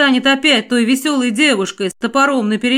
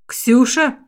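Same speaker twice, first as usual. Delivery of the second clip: surprised; 2 semitones higher than usual. A narrator's reading. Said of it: "удивлённо воскликнул я".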